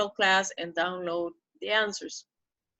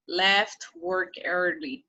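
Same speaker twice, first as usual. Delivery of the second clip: slow model reading; slower than usual. Shown as a demonstration. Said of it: "slow model reading".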